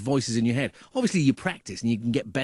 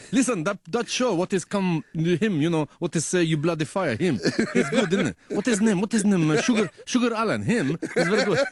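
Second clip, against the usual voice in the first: eager, higher Middle Eastern shopkeeper voice; Middle Eastern accent; 5 semitones higher, 3 dB louder than usual. Retold or imitated. Shopkeeper asks about the show his customer's wife works on, Middle Eastern accent. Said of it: "it's a shopkeeper that I go to".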